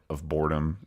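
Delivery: ordinary talk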